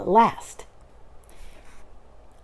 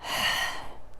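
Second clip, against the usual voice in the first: soft breathy sigh; 11 dB quieter than usual. Acted. Pooh stuck in the hole, sigh. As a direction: let out a long sigh.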